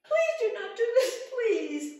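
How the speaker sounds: high, loud, tearful begging; queen weeping to the little man; crying plea. Acted. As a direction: plead through sobs. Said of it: "Rose starts to cry and cry".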